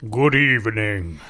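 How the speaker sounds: low, mock-formal "good evening" voice; put-on greeting voice. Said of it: "I don't know why I did this voice".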